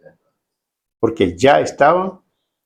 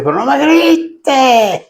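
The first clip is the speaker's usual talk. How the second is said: high-pitched drawn-out plea, stretched on grites; acted high, slow outcry; mock protesting cry; 9 semitones higher, much slower than usual. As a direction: wail it in mock protest at being yelled at. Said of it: "alguien lo gritó y dice".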